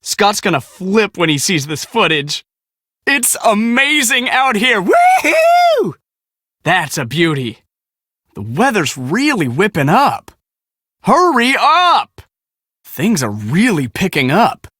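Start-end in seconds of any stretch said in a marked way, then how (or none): 3.1-5.9 thrilled shout. Acted. Chaser marvelling at the storm, thrilled shout. 11.0-12.2 impatient call. Acted. Urging slow helper.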